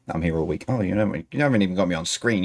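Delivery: ordinary talk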